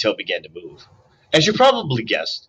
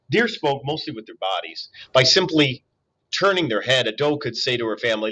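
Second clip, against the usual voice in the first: ordinary delivery, nothing marked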